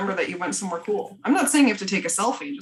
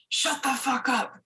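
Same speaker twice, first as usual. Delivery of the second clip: rapper imitation; 4 semitones higher, 4 dB quieter, slower than usual. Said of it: "where he's like".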